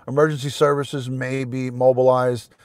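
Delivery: ordinary talk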